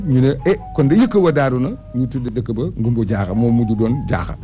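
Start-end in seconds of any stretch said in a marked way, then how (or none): none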